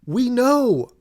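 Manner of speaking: high, loud exasperated yell; indignant high-pitched ranting; exasperated outburst. Retold or imitated. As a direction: shout back at the preachy ad, fed up. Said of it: "and it's like".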